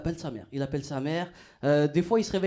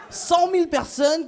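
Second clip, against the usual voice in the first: incredulous shout; 9 semitones higher, slower than usual. Real, not acted. Comedian ranting at absurd followers.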